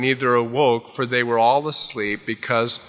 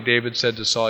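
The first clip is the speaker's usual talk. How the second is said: ordinary delivery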